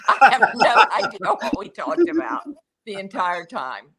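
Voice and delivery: high, loud, laughing disbelief; laughing admission. Retold or imitated. Starstruck fan telling colleague about lunch, laughing. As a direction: burst into laughter mid-sentence. Laughing at own blankness after meeting.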